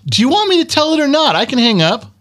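very high-pitched indignant challenge; exasperated. Real, not acted. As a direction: threaten to quit, pitch shooting way up. Exasperated at co-host's teasing.

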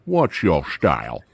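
low, slow, deadpan movie line; low movie quote. Retold or imitated. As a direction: drop low and slow like the film. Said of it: "Way of the Dragon".

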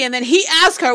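ordinary talk

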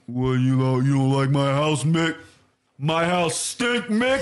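slow, mumbling imitation of Rocky; a lightly voiced Rocky, dragging the words; Rocky impression. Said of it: "and he goes".

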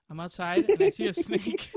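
can't keep a straight face telling the snake story; laughing. Real, not acted. Telling friends the snake story, laughing through it.

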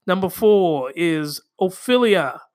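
ordinary talk, nothing marked

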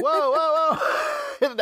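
high, laughing startled whoas trailing to a breath. Retold or imitated. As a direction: jump in surprise, laughing. Startled by voice breaking in.

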